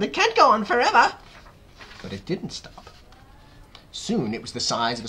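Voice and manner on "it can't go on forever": high, loud exasperated cry; Aunt Spiker yelling. A narrator's reading. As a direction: yell in protest. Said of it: "Aunt Spiker yelled".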